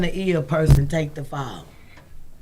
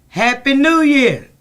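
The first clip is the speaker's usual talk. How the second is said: sarcastic greeting; 5 semitones higher, 6 dB louder than usual. Real, not acted. Sarcastic greeting then denial.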